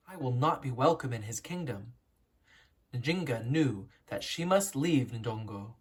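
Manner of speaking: clear, lively read-aloud storytelling; expressive storybook narration to young listeners; story narration. A narrator's reading. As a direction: read it like a picture book for children. Reads picture book aloud.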